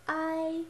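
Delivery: "I" held high and level; first tone. Shown as a demonstration. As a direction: sing out "I" on one high, even pitch. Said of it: "here are the four tones".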